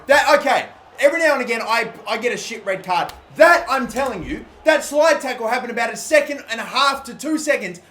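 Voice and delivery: loud, high outraged protest at the referee; furious rant. Real, not acted. Gamer yelling at the game over a late red card, furious. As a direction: rage at the unfair red card.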